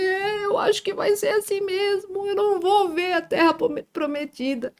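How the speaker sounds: high, drawn-out whimper; mock tearful wail. Retelling her despair to the pastor, mock crying. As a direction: whine it high and slow, imitating your crying self.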